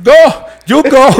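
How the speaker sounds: slow, high shooing voice; high, loud Jamaican dude. Retold or imitated. Dude shooing tourist away.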